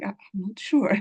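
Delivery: unsure, whispered reply. Retold or imitated. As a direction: breathe it out, nervous and unsure. Unsure learner answering someone.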